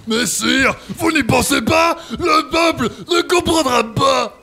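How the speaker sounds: shouted protest. Acted. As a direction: cry out to the king in alarm. Valet protests loudly to king. (cri de protestation aigu)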